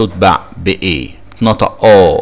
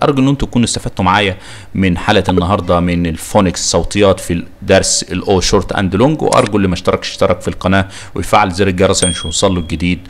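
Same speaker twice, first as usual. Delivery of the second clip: ordinary talk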